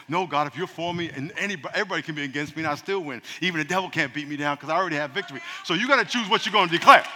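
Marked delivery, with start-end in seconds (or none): none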